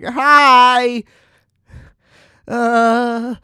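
overexcited fan hello; starstruck hi. Retold or imitated. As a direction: blurt it out, high and loud, like a nervous fan. Starstruck greeting to Shirley.

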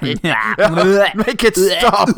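drawn-out mock anguish. Groaning at the pun.

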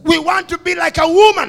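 loud, high preacher's shout; preaching. Real, not acted. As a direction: fire up the congregation. Preaching at full force.

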